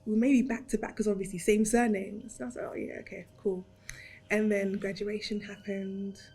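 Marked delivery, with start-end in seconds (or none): 2.7-3.7 soft offhand murmur; quiet. Retold or imitated. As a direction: shrug it off quietly. Casual agreement.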